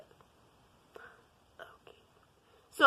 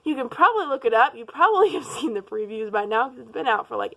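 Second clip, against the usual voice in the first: normal talking voice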